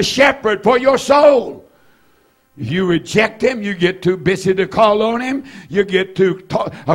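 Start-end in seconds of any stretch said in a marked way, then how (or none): 0.0-1.6 soaring pulpit cry; shouted preaching. Real, not acted. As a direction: lift it high to the back row. Preacher rising to a climax.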